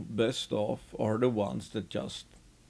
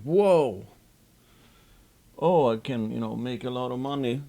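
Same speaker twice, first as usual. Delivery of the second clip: mock enthusiasm; 4 dB louder than usual. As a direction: imitate a novice investor getting carried away. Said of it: "then they get enthusiastic".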